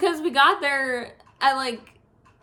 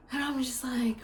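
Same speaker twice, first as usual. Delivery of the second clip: whisper; 7 dB quieter than usual. Real, not acted.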